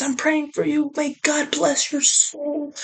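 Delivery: lightly voiced sweet religious lady; pious caller. Reading out the religious family's texts, mild mock piety. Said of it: "like a super religious family".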